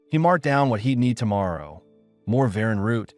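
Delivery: usual speaking voice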